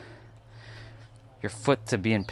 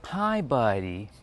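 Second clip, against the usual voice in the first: slow, drawn-out friendly greeting; imitating a neighbor; 4 semitones higher, much slower than usual. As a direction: stretch out each hi buddy. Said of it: "funny how he speaks".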